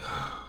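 low, soft imitation of a huffy cashier; exasperated huff. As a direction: sigh out your annoyance without words. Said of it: "She huffs again".

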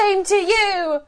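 high, loud, drawn-out retort; offended charity collector. Acted. Charity collector answering Scrooge, high and loud. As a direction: throw it back in stagey indignation.

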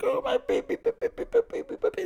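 put-on very high, soft cooing at a pet, baby, baby; mock lullaby baby talk. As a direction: coo like a doting cat owner singing to her baby. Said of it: "into, like, this little lullaby fucking thing".

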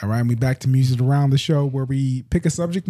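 usual speaking voice

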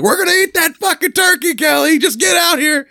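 high-pitched imitated demand; mock yell through door. Family pounding on Kelly's bedroom door, high mock yelling. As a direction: act out yelling at someone behind a closed door. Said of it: "they're knocking on the door like".

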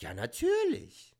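high, slow German reply. Retold or imitated. Comic answers the woman in German, high and slow. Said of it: "we started speaking German".

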